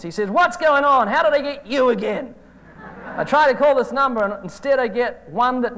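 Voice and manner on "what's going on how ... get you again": higher and louder, startled exasperation; baffled caller. Retold or imitated. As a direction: sound caught out and annoyed. Caller reaching the same number.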